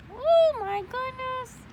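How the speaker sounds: high, slow gasp of surprise; surprised. A narrator's reading. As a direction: react like a shocked storyteller. Narrator reacting.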